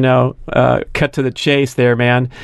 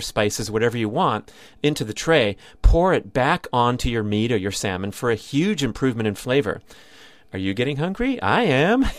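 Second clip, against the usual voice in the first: softer, intimate podcast ad voice; relaxed host-read commercial; 7 dB quieter than usual. Acted. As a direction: pitch the grill like a friendly host. Sponsor ad read.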